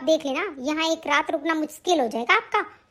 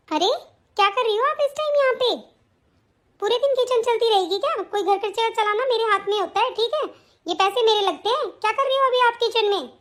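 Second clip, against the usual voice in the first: scolding daughter-in-law; 4 semitones higher than usual. Acted.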